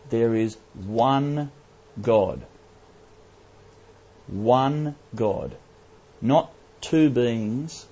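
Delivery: ONE and GOD drawn out slowly, each set apart by pauses; slow, weighty stress on "one God", word by word; emphatic declaration. Real, not acted. Preacher waking a drowsy congregation, most emphatic. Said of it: "I can't stress this strong enough".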